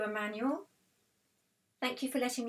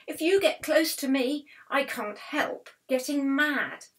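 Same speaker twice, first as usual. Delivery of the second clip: raised, loud voice of an angry wasp; 5 semitones higher, 7 dB louder than usual. A narrator's reading. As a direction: warn them off, loud and high. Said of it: "who looked angry".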